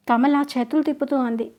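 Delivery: ordinary delivery, nothing marked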